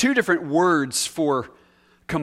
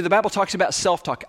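usual speaking voice

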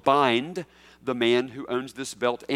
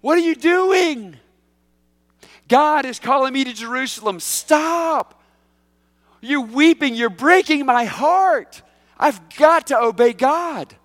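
much higher, loud, slow impassioned cry; anguished Paul; 10 semitones higher, 8 dB louder, slower than usual. Retold or imitated. Pastor voicing Paul begging friends to stop weeping, anguished cry. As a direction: plead with them through heartbreak. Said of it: "I can hear Paul".

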